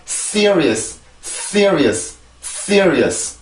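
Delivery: "Serious" as crisp English, strong s, stressed first syllable; English pronunciation demo. Shown as a demonstration. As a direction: say "Serious" English-style in three quick syllables, falling. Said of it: "자, 다시 해봅니다". (영어식 "Serious" 발음 시범)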